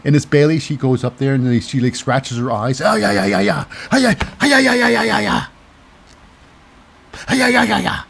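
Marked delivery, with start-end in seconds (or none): 2.8-8.0 gloating taunt. Acted. Bayley raking eyes, gloating.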